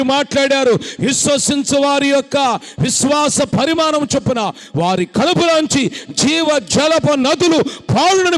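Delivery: loud and high, climbing to a peak; full-force preaching. Acted. Preacher urging believers to raise hands, loud and high. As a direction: shout it out with rising fervour.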